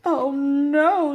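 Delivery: high, loud wail of dismay; dismayed moan. A narrator's reading. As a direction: moan at the bad news. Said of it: "and Stripes moaned".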